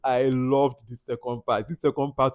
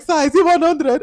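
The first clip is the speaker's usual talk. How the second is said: high, loud imitation of a blindfolded girl; mock timid question; 12 semitones higher, 6 dB louder than usual. Mimicking the blindfolded girl asking the count, high and loud. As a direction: pipe it up high like she's lost and unsure.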